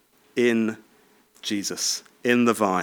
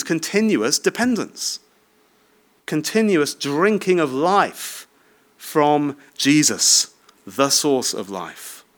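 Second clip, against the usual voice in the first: slow emphasis; slower than usual. Real, not acted. Lingering on key phrase.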